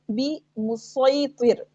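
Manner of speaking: slow "मुसैतिर" with heavy emphatic s; saad only. Shown as a demonstration. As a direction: recite "मुसैतिर" with a thick saad. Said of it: "अब इसमें सिर्फ सौद पढ़ना है".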